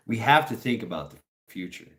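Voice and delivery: ordinary talk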